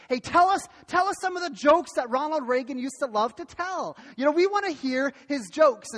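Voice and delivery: mildly acted eager begging; eager request. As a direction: ask keenly for a favourite treat. Acts out eager request for jokes.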